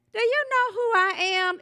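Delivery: high, affronted demand in a joke; indignant. Acted. Elderly lady to an usher in a joke, indignant.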